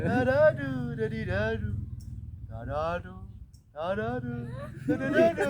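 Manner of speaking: exaggerated sing-song syllables, swooping high; schoolroom poetry intonation. Shown as a demonstration. Teacher mocking how pupils read poems, sing-song chant. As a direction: parody a rote poem recital with stretched vowels. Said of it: "membaca puisi dengan intonasi".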